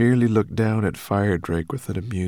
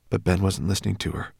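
ordinary delivery

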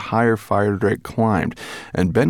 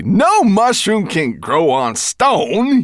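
slow, high, loud explaining; grinning creature; 13 semitones higher, 6 dB louder, much slower than usual. A narrator's reading. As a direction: explain it very slowly through a grin. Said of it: "grinning broadly".

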